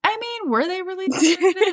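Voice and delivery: high, mocking question; high sarcastic squeak of doubt; sarcastic disbelief. Real, not acted. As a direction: scoff at the idea they were apart.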